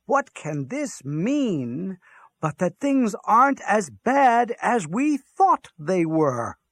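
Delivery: slow, high, swelling wonder; awed hope. A narrator's reading. Hitler marveling that things may improve, awed hope. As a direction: stretch it out grandly, marveling.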